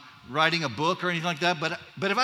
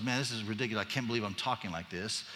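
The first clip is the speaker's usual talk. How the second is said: low, soft self-reproach; acted aside to himself, kept low; talking to himself; 8 semitones lower, 7 dB quieter than usual. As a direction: imitate someone privately chiding himself. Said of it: "he's telling himself".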